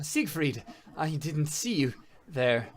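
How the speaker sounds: nervous stammer. Acted. GM voices a scared NPC.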